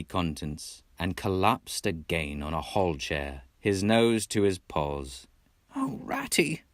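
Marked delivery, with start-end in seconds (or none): 5.7-6.6 a dismal cry; cried dismally. Acted. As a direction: voice lifting high in despair. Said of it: "he cried dismally".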